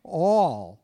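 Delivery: emphasized "All" as its own sentence; stress on ALL. Shown as a demonstration. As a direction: punch the single word "All". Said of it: "we all emphasize the word A L L".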